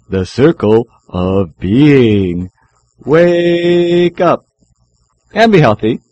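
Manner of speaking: loud theatrical radio opening; dramatic announcer. Acted. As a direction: proclaim it like a showman.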